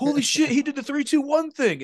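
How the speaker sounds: very high-pitched hammed-up realization; squeaky-high pretend shock; mock astonishment. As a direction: imitate a contestant suddenly catching on. Imitating chefs suddenly catching on.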